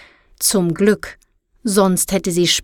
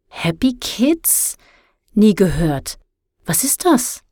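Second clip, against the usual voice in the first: puzzled; 2 semitones higher, slower than usual. A narrator's reading. Girl puzzled by the name.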